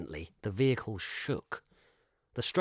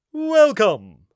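loud, high, ringing greeting; booming welcome; 12 semitones higher, 15 dB louder than usual. Acted. Shopkeeper booming out from the shadows. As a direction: fill the shop with the word. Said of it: "a voice boomed".

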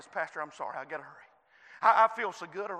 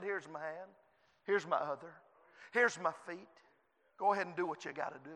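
soft surrender; 5 semitones lower, 8 dB quieter, faster than usual. Acted. Jesus offers himself, voiced softly.

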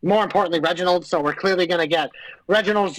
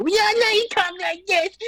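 much higher, squeaky mock voice; mocking impression; 13 semitones higher than usual. Teasing the co-host with a squeaky mock voice. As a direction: squeak it back at him. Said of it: "That nice fake accent you got going on".

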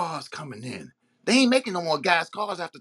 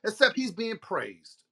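ordinary talk, nothing marked

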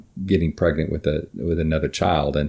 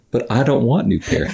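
lightly voiced teary boy, higher; a hint of a tearful little kid; teary child; 6 semitones higher than usual. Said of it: "he gets all teary".

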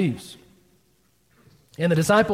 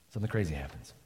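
hushed repeat; 10 semitones lower, 14 dB quieter, faster than usual. Shown as a demonstration.

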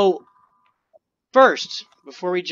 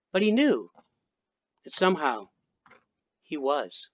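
dramatic story narration. A narrator's reading. Storyteller reading of a being becoming aware, portentous. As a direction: weigh each phrase like a sci-fi prologue. Reads own short story.

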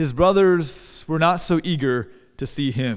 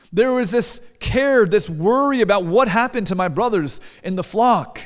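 normal talking voice

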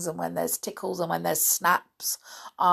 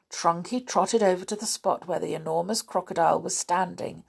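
ordinary delivery

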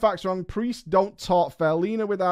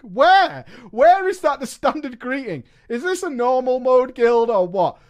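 higher, louder, incredulous; loud outraged disbelief; 7 semitones higher, 6 dB louder than usual. Real, not acted. Shouting in disbelief. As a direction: streamer reading a donation, shouting in disbelief.

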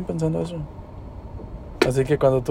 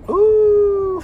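chillido agudo. Real, not acted. Grito agudo sorprendido.

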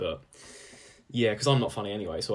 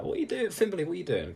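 much higher mock heckler voice; jeering crowd; 8 semitones higher, faster than usual. Crowd jeering at Fimberley, mock heckling. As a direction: heckle him like an impatient crowd. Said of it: "They're like".

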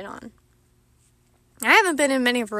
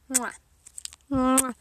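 air kiss. Pretend. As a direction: pretend kiss sound. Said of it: "a kiss on the forehead".

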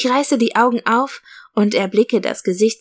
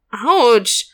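Autsch gerufen; 3 semitones higher, 3 dB louder than usual. A narrator's reading. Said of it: "rufe ich".

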